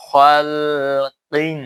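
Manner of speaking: খালকিন slow with light ক and qalqala; slow recited খালকিন; correct recitation. Shown as a demonstration. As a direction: stretch খালকিন slowly in tajweed style. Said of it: "কলকলা হবে অবশ্যই, কিন্তু বারিক হবে".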